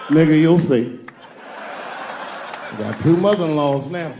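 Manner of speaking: loud, weary [name]; mock [name]. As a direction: bellow it like a fed-up [name]. [name] voiced in story.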